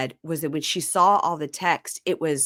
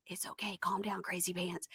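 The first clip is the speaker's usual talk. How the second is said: much softer calming murmur; soothing hush; 17 dB quieter than usual. Retold or imitated. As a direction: hush her gently, teasing. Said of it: "Benny is standing next to her like".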